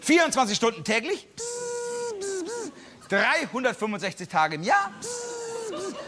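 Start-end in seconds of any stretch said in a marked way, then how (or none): 1.3-2.7 high, thin buzzing noise like a radar. Pretend. GPS buzzing again. 5.0-5.9 high, thin buzzing noise like a radar. Pretend. GPS buzzing again.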